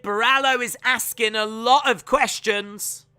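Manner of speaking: urgent sportscaster voice; play-by-play. Real, not acted. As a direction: ride the rising excitement of a submission attempt. Live fight commentary.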